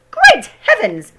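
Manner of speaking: high, loud, slow shocked cry; startled cry. A narrator's reading. Father crying out at the giant hen. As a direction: cry out in alarm. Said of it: "he cried".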